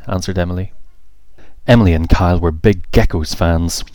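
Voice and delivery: plain reading voice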